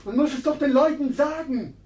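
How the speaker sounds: very high, loud, far-off calling; excited cry. Acted. Townswoman shrilly calling the news to Mabel. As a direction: shout the news out across the street.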